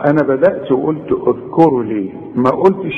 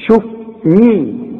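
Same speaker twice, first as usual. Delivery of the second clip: usual speaking voice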